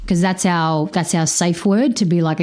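ordinary talk, nothing marked

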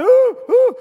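high, loud wailing "ooh"; hammed-up imitation of a bawling child; mock crying. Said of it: "He's crying".

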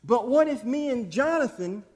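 normal talking voice

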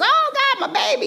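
high, loud retold panic cry; loud mock scream of a terrified mother. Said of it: "I was hollering and screaming".

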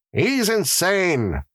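high, drawn-out jailer's verdict; air of finality. Acted. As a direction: pronounce it slowly and high, as final. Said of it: "with an air of finality".

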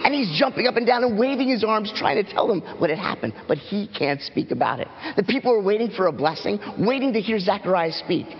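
ordinary talk, nothing marked

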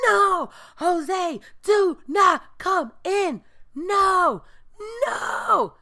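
high, loud, slow yelling no; shouted warning. Retold or imitated. Security guard yelling at Jose not to come in, high loud shout. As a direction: yell him back from the door. Guard stops man entering.

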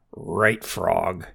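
mildly snappish comeback; snapped retort. A narrator's reading. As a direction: snap back at the frog, understated. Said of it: "snapped Bernie".

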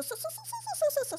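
high, stretched-out "so, so, so"; drawn-out so. Acted. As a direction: reel off the so's way up high, slowly. Reading out a long emphatic so.

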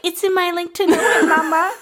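very high, put-on influencer squeal; high-pitched cutesy influencer impression; mock influencer voice. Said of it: "impersonates their voice".

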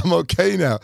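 put-on a kid insisting he's fine; little boy reassuring. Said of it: "I said, I said".

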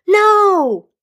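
high, loud anguished No; scream in pain. A narrator's reading. Healer screaming as her world collapses, anguished. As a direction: cry out as if stabbed. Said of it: "the healer screamed in pain".

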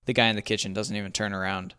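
plain reading voice

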